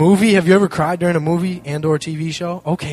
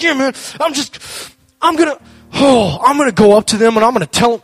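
worked up; 7 semitones higher, 8 dB louder than usual. Retold or imitated. Friend fired up, planning revenge.

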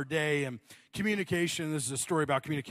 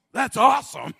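enthusiastic awesome; 8 semitones higher, 10 dB louder than usual. Retold or imitated. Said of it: "You're like".

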